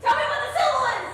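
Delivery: loud, high, rushed demand; exasperated shout. Real, not acted. Player fed up with a teammate's charades, exasperated shout. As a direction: fire it out fast, fed up and loud.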